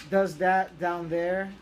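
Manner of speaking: ordinary talk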